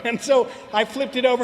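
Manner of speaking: ordinary talk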